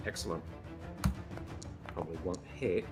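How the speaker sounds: ordinary talk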